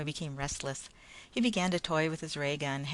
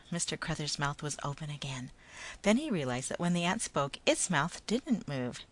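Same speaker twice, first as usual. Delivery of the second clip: usual speaking voice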